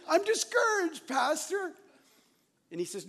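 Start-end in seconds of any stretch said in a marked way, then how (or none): 0.1-1.7 much higher, louder, sing-song holy tone; mock pious pastor voice. Pastor mocks himself answering his counselor in a pious, much higher voice. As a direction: ham up a pastor spiritualizing his feelings. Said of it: "a pastor has to spiritualize it".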